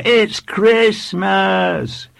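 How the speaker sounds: put-on high, drawn-out little boy's cry; eager small boy. A narrator's reading. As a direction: call it out slowly like a child in class. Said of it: "volunteered a small, wiry boy".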